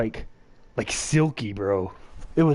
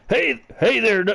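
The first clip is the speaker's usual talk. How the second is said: higher, louder put-on cowboy greeting; Arthur Morgan voice; 10 semitones higher, 3 dB louder than usual. Shown as a demonstration. Friend retrying the Arthur Morgan voice on request. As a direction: ham up a rough outlaw hello. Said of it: "Let's hear it one more time".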